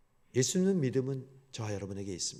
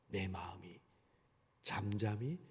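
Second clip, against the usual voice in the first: ordinary talk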